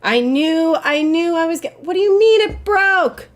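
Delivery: loud, high indignant shout; outraged yell. Real, not acted. Streamer shouting at the game over the broken weapon, outraged.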